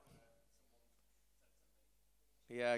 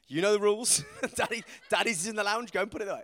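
put-on higher, louder bossy little boy; cheeky son mimicking parents; 7 semitones higher, 10 dB louder than usual. Five-year-old turning the rule on his dad, bossy. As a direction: boss Daddy around with his own rule. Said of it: "And he was like".

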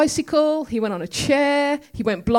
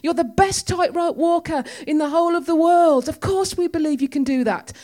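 crowd; 6 semitones higher than usual. Retold or imitated. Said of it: "And all going".

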